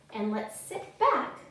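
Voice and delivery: usual speaking voice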